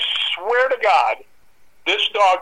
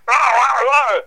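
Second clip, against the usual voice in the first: higher, louder barking snarls; dog snarling; 6 semitones higher, 4 dB louder, much slower than usual. Retold or imitated. Imitating the dog lunging and snarling at the air. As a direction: bark out a snarling dog attack. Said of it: "biting and growling".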